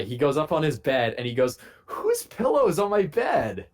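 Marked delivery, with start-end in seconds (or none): 1.9-3.7 high, slow, put-out question; imitating the confused roommate finding his pillow; mock indignant roommate. Roommate back from rafting asks about his pillow, mock indignant.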